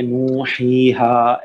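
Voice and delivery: "नू, ही, हा" with long vowels, flowing without stops; full two-count reading. Shown as a demonstration. Teacher answering how to read the word, full-length flowing vowels. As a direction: hold each vowel of "नू, ही, हा" fully, never pausing. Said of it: "बिल्कुल रुकेंगे नहीं, लेकिन हरकत पूरी करेंगे".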